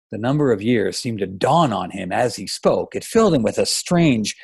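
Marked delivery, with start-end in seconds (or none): none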